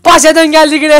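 excited cry. Real, not acted. Board game, sends him back.

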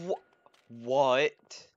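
loud stunned what; shocked shout. Real, not acted. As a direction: yell in disbelief. Shocked yell.

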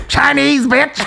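high comic punch; Dave Chappelle impression. Comic explaining his slip, high mock stand-up voice. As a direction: throw it up high and emphatic. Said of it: "I was trying to get in the zone".

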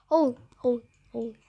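normal talking voice

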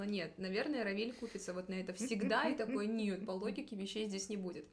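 ordinary delivery

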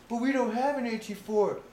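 very high, loud helpless objection; mock whiny protest. Gunner answers his angry superior, high mock protest. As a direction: mimic a hapless subordinate protesting.

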